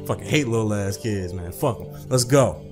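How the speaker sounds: ordinary delivery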